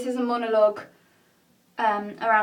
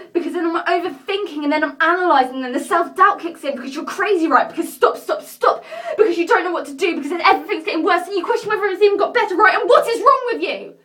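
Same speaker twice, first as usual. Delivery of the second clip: loud, high, frantic self-interrogation; racing thoughts boiling over, louder and higher; spiralling panic; 8 semitones higher, 10 dB louder than usual. Acted. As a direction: build to a shouted outburst of self-doubt. Acting out spiralling self-doubt.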